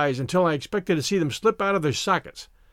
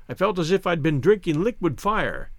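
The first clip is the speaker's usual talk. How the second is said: ordinary delivery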